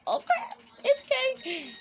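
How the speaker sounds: sudden high yelp of surprise; surprised. Retold or imitated. Fan spotting Kane in the crowd, high surprised squeal. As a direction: squeal as you spot him. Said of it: "I was like".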